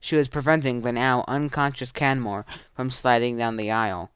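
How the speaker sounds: usual speaking voice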